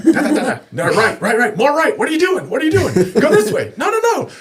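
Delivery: high, loud, excited directions; bossy fishing buddy. Retold or imitated. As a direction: shout directions from the bow. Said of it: "he tells me which way to go".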